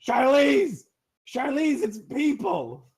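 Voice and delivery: high, loud call across the water. Acted. Guard calls to Charlize in the water, loud and high. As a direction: yell for the friend in the water.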